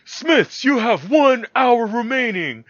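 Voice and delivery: high, slow, grand TV announcer call; host announcement. Retold or imitated. Imitating the Forged in Fire host, grand slow announcement. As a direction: announce it like a game show host. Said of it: "And he goes".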